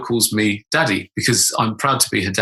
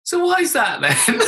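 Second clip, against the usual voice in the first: high, slow daughter's question; little voice; 12 semitones higher than usual. Retold or imitated. Daughter pressing dad through the door, little voice. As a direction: ask it high and drawn out, childlike. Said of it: "this little voice from outside the door".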